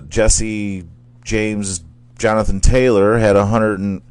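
ordinary talk, nothing marked

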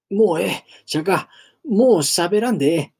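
loud, high desperate "stop talking"; distraught cry over a dying friend; anguished pleading. Acted. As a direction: beg him to save his strength.

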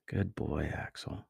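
faint, breathy half-whisper; broken and faint. A narrator's reading. Wounded Miko praising the lion Axel, broken and faint. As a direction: barely get the words out. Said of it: "Miko's voice was broken and faint".

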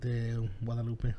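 "De Guadalupe" slowly in Spanish; careful Spanish "De Guadalupe", unhurried; reading foreign language. Real, not acted. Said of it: "I'm trying to read it in Spanish".

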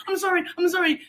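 high, repeated apology; flustered apology. Retold or imitated. Caught clowning by a neighbour, flustered high apology.

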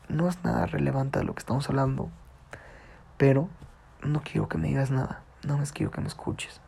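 soft, hushed plea; quietly asking the neighbor only to listen. Retold or imitated. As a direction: ask gently to just be heard. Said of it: "Y le dije".

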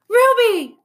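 high, loud delighted cry; joyful exclamation. A narrator's reading. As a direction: call her name in happy surprise. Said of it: "I exclaim".

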